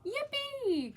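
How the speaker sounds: high squealing yippee; excited cry. A narrator's reading. Froggy cheering at the news, high excited squeal. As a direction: leap up with a high happy squeal. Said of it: "cried Froggy".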